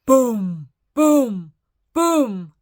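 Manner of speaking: loud, falling storybook booms; booming footsteps. A narrator's reading. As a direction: sound out each heavy footstep. Imitating giant's footsteps.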